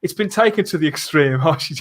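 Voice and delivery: ordinary talk, nothing marked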